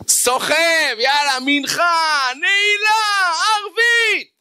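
high, drawn-out strained calls; hammed-up high strain; straining to carry on. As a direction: push each word out high and stretched. Acting out dragging through prayers.

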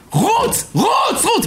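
high, urgent cry of a command; mother shouting. Retold or imitated. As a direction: yell it like a mother sending her child running. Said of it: "צועקת לי". (צעקה גבוהה ודחופה של אמא)

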